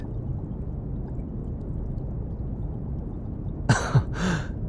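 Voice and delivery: hushed, breathy laugh; soft teasing chuckle. Acted. Researcher hearing the bodyguard deny being stubborn, soft chuckle. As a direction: chuckle under your breath at a friend.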